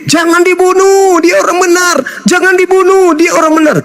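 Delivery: high-pitched sustained protest; acted crowd shout. Preacher voicing Muslims echoing God's command, acted shouting. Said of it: "Orang Islam ikutin".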